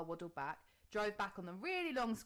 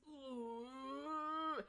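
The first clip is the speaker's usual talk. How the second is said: pained ooh; 5 semitones higher than usual. Retold or imitated. Contraction mid-sentence.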